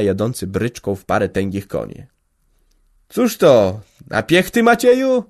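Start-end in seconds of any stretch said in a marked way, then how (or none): 3.1-5.2 high, bright-pitched lady calling out; organist's wife calling to a farmer on foot; lady's voice. A narrator's reading. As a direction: call out to him from the carriage, higher. Organist's wife calls from carriage.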